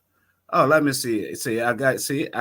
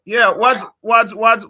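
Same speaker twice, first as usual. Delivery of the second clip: loud, high-pitched, halting question; calling out to caller; 7 semitones higher, 8 dB louder, slower than usual. Real, not acted. Host questions reporter on the line, calling out loudly. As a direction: raise your voice to reach the caller.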